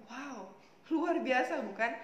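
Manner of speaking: ordinary delivery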